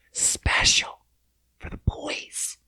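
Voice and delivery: hushed, voiceless aside; mock whisper. Mocking Trump's special stage, in a playful whisper. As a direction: whisper it like a secret tease.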